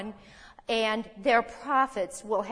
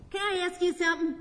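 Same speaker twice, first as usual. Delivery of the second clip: imitating a puzzled student; 8 semitones higher, faster than usual. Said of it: "he goes".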